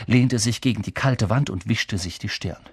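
usual speaking voice

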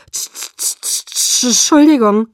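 chattering stutter breaking into a loud apology; stuttering from cold. A narrator's reading. Freezing Bella apologising to Jacob, chattering stutter. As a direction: stutter through chattering teeth, then burst out the word. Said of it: "stotterte ich".

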